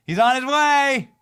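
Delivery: excited shout. Shown as a demonstration. Host hyping viewers about Trump's trip, excited shout. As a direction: belt it like big news arriving. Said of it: "repeats with rising excitement".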